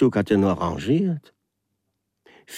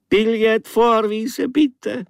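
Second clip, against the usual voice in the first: much higher, slow official call; conductor call; 5 dB louder, slower than usual. Acted. Foreign conductor asking the waking traveller for his ticket. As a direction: sing out like a conductor.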